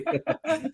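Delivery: high burst of laughter; high giggling laugh. Real, not acted. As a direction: crack up mid-sentence. Cracks up mid-sentence at co-host.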